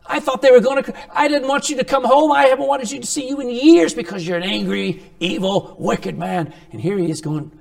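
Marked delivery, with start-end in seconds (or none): 0.0-6.5 shocked family. Retold or imitated. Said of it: "And they go".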